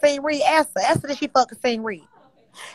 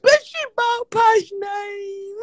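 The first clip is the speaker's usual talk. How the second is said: very slow, high crying; tearful wail; 7 semitones higher, much slower than usual. Real, not acted. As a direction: drag it out through sobs. Crying, repeating the accusation.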